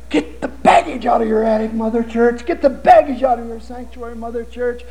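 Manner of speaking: rising preacher's plea; preaching. Acted. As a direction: call out to the church. Preacher pleads with church.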